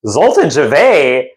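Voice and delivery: acted-out amazed outburst; astonished greeting. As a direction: exclaim as if running into a long-lost friend. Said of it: "and I'm like".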